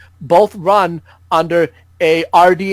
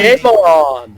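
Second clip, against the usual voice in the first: loud sign-off; 5 dB louder than usual. Real, not acted. Sign-off shout.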